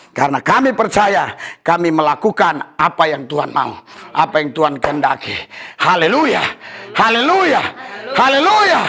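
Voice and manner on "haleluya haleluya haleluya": stack the calls up to a shout; rousing the congregation haleluya by haleluya; building praise shouts. Real, not acted.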